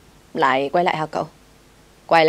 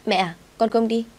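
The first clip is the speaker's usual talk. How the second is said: put-on higher, sweet little girl; clear young girl voice; 5 semitones higher than usual. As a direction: chirp it like a young bird. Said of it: "giọng nói như là chim non thánh thót".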